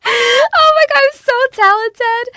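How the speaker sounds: high, loud mock boast; mock self-praise. Real, not acted. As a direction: gush about yourself, tongue in cheek. Mock boasting about piano.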